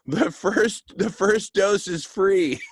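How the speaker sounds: high, loud, laughing sales pitch; joking. Pretend. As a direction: crack yourself up delivering the punchline. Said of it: "I'm being funny".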